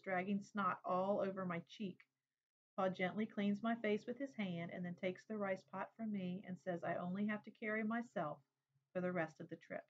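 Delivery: usual speaking voice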